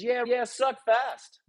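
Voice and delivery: high, slow Australian drawl; Australian accent. Retold or imitated. Head pro telling slow golfers to hurry, high slow Australian accent. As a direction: drawl out a cheeky Aussie quip. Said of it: "He goes".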